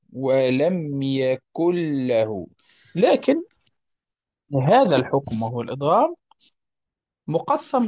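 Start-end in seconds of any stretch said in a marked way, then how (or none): 0.1-2.5 "ولم يكن له" drawn out, the n vanishing into a long l; إدغام demo. Shown as a demonstration. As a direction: recite "ولم يكن له" very slowly as yakul-lahu. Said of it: "يصبحان حرفا واحدا مشددا". ("ولم يكن له" ببطء مع إدغام النون في اللام)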